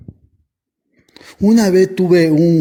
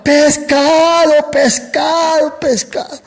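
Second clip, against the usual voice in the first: long, high fishmonger's cry; drawn-out, high-pitched hawker's call; street vendor shout; 11 semitones higher, slower than usual. Retold or imitated. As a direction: call out to sell fish across the street. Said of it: "Y gritaba".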